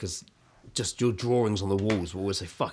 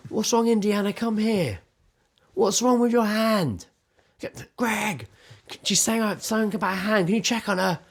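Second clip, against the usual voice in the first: raised, higher voice calling from the shower; retold shouting; 10 semitones higher than usual. Partner calling from the shower to the bedroom, higher, worried shout. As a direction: call out to the bedroom with worry. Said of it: "I could hear her shouting to the bedroom".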